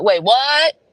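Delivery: can't believe what Zach said; shocked. Retold or imitated. Mom hearing Zach saw it, shocked disbelief.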